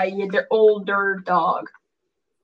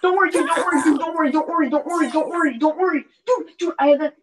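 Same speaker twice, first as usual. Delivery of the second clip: panicked reassurance; 7 semitones higher, faster than usual. Real, not acted. High panicked repetition.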